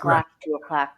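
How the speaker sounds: usual speaking voice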